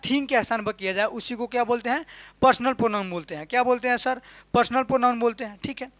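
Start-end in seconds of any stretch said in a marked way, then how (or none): none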